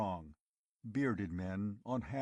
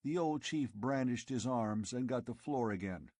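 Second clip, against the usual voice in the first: plain reading voice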